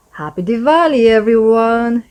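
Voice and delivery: a happy shout over the fireworks; cheerful cry. Acted. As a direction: call it out to the whole family. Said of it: "he cries".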